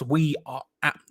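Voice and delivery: ordinary delivery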